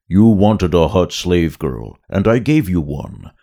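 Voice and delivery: big booming Hutt voice; Jabba voice. Acted. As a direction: rumble like a giant slug boss. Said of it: "a deep second sound".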